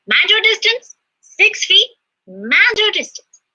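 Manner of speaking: high, loud call telling passersby to keep distance. Retold or imitated. To strangers on Manhattan streets, a high loud warning call.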